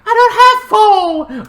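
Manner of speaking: high, loud put-on squawk; shouted refusal. Old lady refusing the siblings, high shouted refusal. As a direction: squawk it back sharply.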